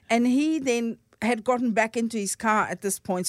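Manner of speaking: normal talking voice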